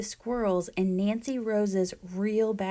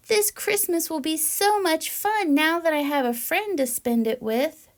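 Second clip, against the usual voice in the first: squirrel voice; 8 semitones higher, 3 dB louder than usual. Acted. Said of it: "says Cousin Squirrel".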